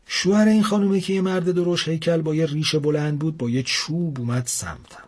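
plain reading voice

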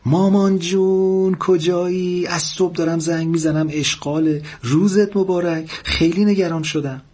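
high-pitched daughter calling from far away; worried daughter. A narrator's reading. Daughter finally reaches her mother by phone, high and worried. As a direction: draw out the greeting, then fret in a high voice.

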